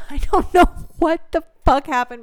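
ordinary talk

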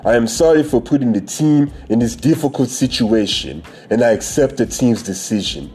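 put-on reading in a Nigerian accent. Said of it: "read that shit in my Nigerian voice".

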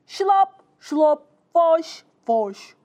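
voiced splashes and whooshes; punchy onomatopoeic sloshing; car wash noises. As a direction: imitate brushes slapping water. Imitates car wash brushes.

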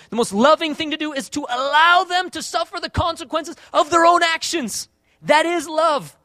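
high, loud, insistent sermon; full-force preaching. Acted. Preacher urging congregation that discipline is love, full-force preaching.

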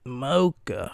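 normal talking voice

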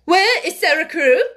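high, loud, sharp demand; almost fierce. A narrator's reading. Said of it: "voice was almost fierce".

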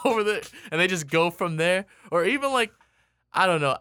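usual speaking voice

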